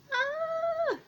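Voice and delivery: high, thin squeal of delight; a short fangirl shriek; excited squeal. Retold or imitated. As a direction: squeal like a starstruck fan. Reacting to a celebrity's request.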